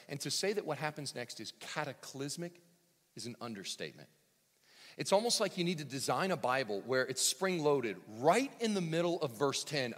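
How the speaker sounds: ordinary talk, nothing marked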